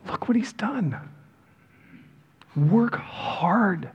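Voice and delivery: hushed awed whisper. Real, not acted. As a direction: breathe it out, urgent and soft. Sermon climax, hushed emphasis.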